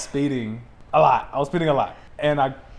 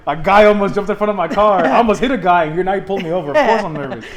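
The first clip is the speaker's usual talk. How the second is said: louder, agitated self-defence; worked-up protest; 4 semitones higher, 6 dB louder, faster than usual. Retold or imitated. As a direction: defend yourself loudly, as if wrongly accused. Protesting to the suspicious cop.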